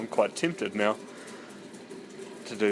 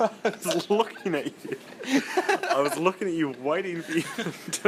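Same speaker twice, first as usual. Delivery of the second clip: high giggling through the words; laughing; 7 semitones higher than usual. Real, not acted. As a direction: laugh while you talk. Laughing while recounting.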